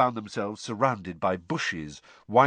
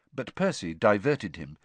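ordinary delivery, nothing marked

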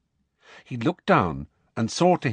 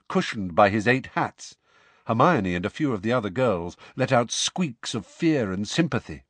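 ordinary delivery, nothing marked

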